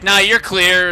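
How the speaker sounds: loud, high dismissive dad voice; mock dad. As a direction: brush it off like a careless dad. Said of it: "her dad was probably like".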